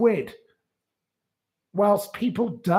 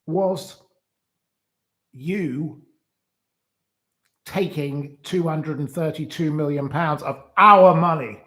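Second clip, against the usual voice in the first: usual speaking voice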